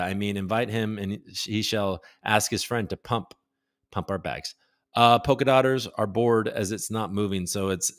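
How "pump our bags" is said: ordinary delivery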